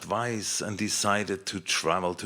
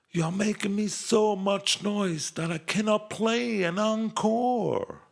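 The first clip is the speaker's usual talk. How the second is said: Kamanza's voice; 12 semitones higher than usual. Acted. Kamanza asks the applauding elements.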